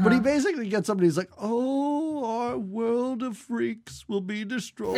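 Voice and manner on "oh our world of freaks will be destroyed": put-on slow, quavering old man voice. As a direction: stretch every word like a tired old man. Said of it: "now I'm not even doing the old man voice anymore".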